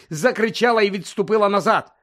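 usual speaking voice

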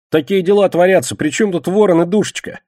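high, loud bewildered protest; exasperated. Acted. Кегля protests to Костоправ, exasperated. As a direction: keep protesting, pitched well up.

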